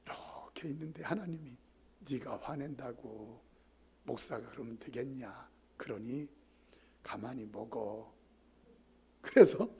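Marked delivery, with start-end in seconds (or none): 2.1-8.1 calm voice of God. Retold or imitated. God's calm reply in story.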